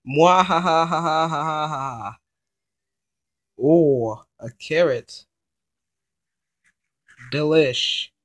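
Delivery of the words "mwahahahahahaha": evil gloat then cackle; villainous taunt, maniacal laugh; mad scientist. Pretend.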